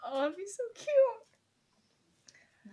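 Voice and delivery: high, drawn-out fangirl squeal; gushing coo. Real, not acted. Fan gushing over an imagined couple scene, gushing coo. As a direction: stretch it out high, melting at cuteness.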